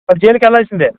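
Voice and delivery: usual speaking voice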